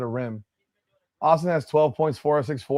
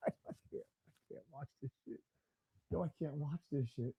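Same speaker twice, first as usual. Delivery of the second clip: much softer, barely audible; defeated mutter; 3 semitones lower, 20 dB quieter, faster than usual. Real, not acted. Fan giving up on the game, quiet defeated mutter. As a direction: mutter it to yourself.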